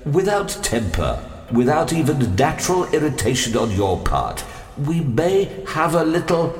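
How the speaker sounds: high, nervous pleading; tremulous. A narrator's reading. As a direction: plead anxiously, pitch rising. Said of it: "he tremulously began".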